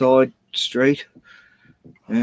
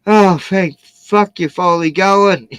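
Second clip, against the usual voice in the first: slow, louder, higher relieved groan; mock relief; 5 semitones higher, 5 dB louder than usual. Dog owner watching the people leave, slow relieved groan. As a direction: drag it out like finally rid of them. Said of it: "and I go".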